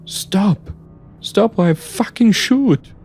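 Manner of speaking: very high, desperate shout; panicked warning. Acted. Narrator warns the advancing driver, panicked. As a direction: warn him off in a panic.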